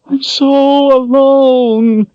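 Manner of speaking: long, very high drawn-out wail. Acted. Kaelin wails her loneliness to Richard, wailing. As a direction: stretch it into a wail of grief. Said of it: "she wailed".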